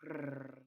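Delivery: low, soft imitated cat purr; mock cat purr, low and hushed. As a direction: purr quietly like a content cat. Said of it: "Tabby purred".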